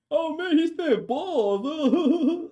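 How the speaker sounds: high, drawn-out silly mimicry; mock the chat in a loud singsong squeal; mocking viewers. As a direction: stretch it out high and teasing. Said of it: "y'all over here be".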